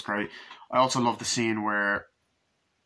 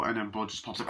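ordinary talk

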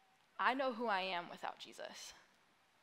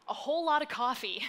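bright blurt; 3 semitones higher, 8 dB louder than usual. Retold or imitated. Blurted reply to coworker.

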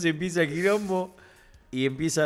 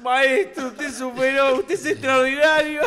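grand praise; 8 semitones higher, 4 dB louder than usual. Retold or imitated. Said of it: "Toscano se paró y dijo".

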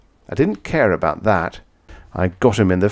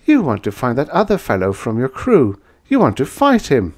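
triumphant; 6 semitones higher, 4 dB louder than usual. A narrator's reading. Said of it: "she said triumphantly".

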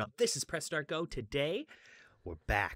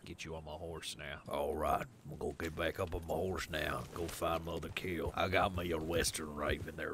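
low-pitched cowboy character voice; imagined cowboy voice; 6 semitones lower, 4 dB louder than usual. Acted. Gamer voicing his cowboy as imagined, low-pitched. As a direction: play the cowboy, pitched well down. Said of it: "that's how he would talk".

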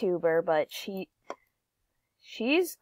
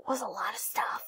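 whisper; 6 dB quieter than usual. Real, not acted. Hushed aside after gruesome run.

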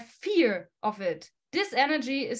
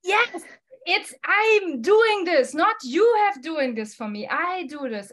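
triumphant excited; 6 dB louder than usual. Retold or imitated. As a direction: proclaim the win like a victory shout. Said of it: "you can switch it and say".

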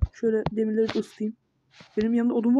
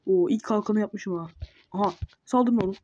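ordinary delivery

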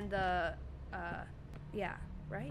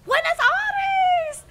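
high, slow, drawn-out shout; 12 dB louder, much slower than usual. Acted. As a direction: belt it out like a dramatic cry. Sudden dramatic outburst.

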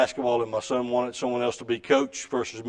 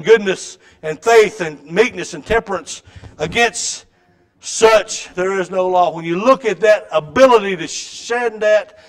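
louder, driving pulpit delivery; fervent preaching; 5 semitones higher, 7 dB louder than usual. Acted. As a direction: preach it with rising fire. Preaching at full force.

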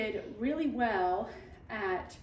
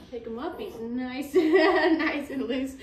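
usual speaking voice

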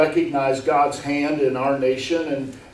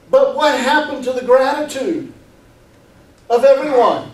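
raised, emphatic pulpit delivery; higher, driving sermon voice; forceful preaching; 8 semitones higher, 5 dB louder than usual. Real, not acted. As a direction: preach it insistently, pitched up.

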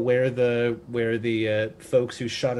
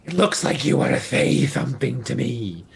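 clicking giant spider voice, lightly done. Acted. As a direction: speak like a spider in wild shape, saying mandibles. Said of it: "You have to do it in the spider voice".

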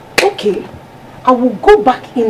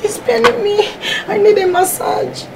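fake pained whine; 10 semitones higher than usual. Wife fakes ankle pain.

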